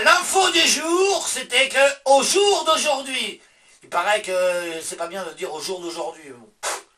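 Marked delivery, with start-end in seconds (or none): 0.0-3.4 higher, slow announcer delivery of the headline; hammed-up slow news anchor voice; mock news read.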